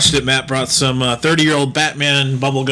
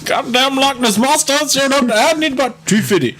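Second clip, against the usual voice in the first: high put-on cartoon voice; a much higher, cartoonish South Park quote; quoting a cartoon character in a high voice; South Park impression; 12 semitones higher than usual.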